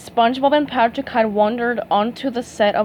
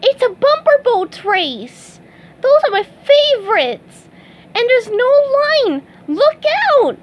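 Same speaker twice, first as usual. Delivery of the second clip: much higher and louder gleeful shouting; bursting with glee; excited shout; 13 semitones higher, 6 dB louder than usual. Acted. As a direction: SpongeBob shouting at the bumper boats. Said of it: "he shouted".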